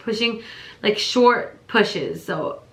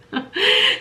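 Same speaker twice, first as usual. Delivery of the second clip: high laugh. Real, not acted. Laughing at the memory.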